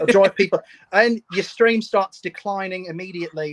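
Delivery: lightly hammed-up Australian voice; Australian accent. Guest jokes the stream is losing viewers, in a mock Australian accent. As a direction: keep the mock Aussie accent rolling. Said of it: "well, now I'm Australian".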